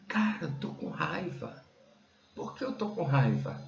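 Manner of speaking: whispery, wondering self-talk; hushed realisation. Retold or imitated. Man admitting to himself he is angry, hushed realisation. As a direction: drop to a near whisper, as if just noticing.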